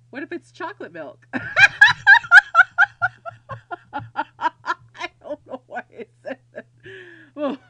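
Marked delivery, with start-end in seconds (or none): 1.3-3.9 loud, high cackling laugh; real helpless laughing fit; burst of laughter. Real, not acted. Player cracking up at chocolate milk joke, loud high laugh. 3.9-6.7 slow words squeezed through laughter; real giggles breaking up the words; laughing. Real, not acted. Player amused by her own joke, helpless laughing.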